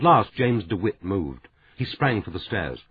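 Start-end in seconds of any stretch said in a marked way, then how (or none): none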